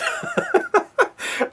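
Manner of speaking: high-pitched cracking up; helpless laugh. Real, not acted. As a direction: crack up at a funny line. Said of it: "couldn't help but crack up".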